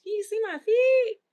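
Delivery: high, loud incredulous repeat; mocking, exasperated echo of the comment; mock disbelief. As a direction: throw the question back in disbelief. Mocks the feet comment.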